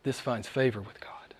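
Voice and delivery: a soft whisper, almost secret; preacher whispering the line before repeating it. Acted. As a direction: breathe it like a secret to the flock. Whispered, then repeated aloud.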